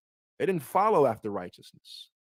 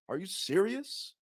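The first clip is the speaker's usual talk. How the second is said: indignant disbelief; 6 semitones higher than usual. Acted. Preacher voices indignant elder son.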